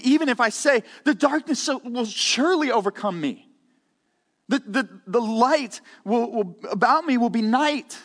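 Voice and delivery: verse read as a panicked cry. Shown as a demonstration. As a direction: say it like the darkness is about to swallow you. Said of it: "That's how we should read that".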